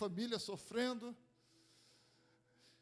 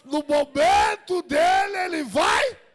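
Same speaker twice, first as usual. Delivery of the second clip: building to loud shouted preaching; fiery sermon shout; preaching at full force; 13 dB louder than usual. Acted. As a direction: preach it out, rising to a roar.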